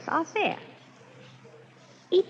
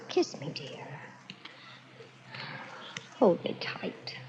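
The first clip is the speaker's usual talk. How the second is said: whisper; 4 semitones lower, 11 dB quieter, slower than usual. Acted. Bride whispers to husband.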